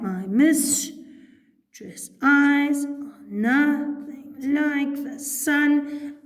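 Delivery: "My mistress' eyes are nothing like the sun" very slowly, split into unstressed-stressed pairs; divided into iambic feet. Shown as a demonstration. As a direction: break "My mistress' eyes are nothing like the sun" into five beats, stressing every second syllable. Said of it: "if we were to divide that".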